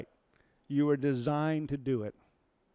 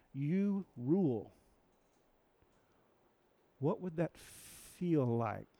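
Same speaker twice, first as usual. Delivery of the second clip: drawn-out, reflective; slow it right down to ponder; slow appeal; 4 dB quieter, much slower than usual. Real, not acted. Preacher inviting the congregation to imagine, slow.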